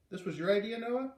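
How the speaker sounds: high, rising surprised question; pleasantly surprised question. Acted. Father asking his son, pleasantly surprised. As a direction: ask it amazed and pleased. Father asks about idea.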